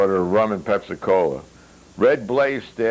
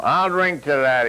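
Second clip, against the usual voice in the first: higher, cheerful toast; lightly voiced Yuri; 8 semitones higher than usual. A narrator's reading. Yuri toasting Red's idea, higher and cheerful. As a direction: raise the glass with a grin.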